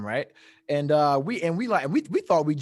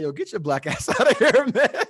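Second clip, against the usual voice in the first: high and loud, laughing through the words; laughing dismissal; 7 semitones higher, 5 dB louder than usual. Retold or imitated. Diver laughing as he sends him out of the pool. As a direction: laugh as you wave him off.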